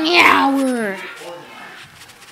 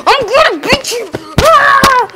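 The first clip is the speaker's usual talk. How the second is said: high, quick yell into a scream; high-pitched attack scream; excited battle cry; 10 semitones higher than usual. Real, not acted. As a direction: yell a threat as you attack. Threatening an enemy in the game.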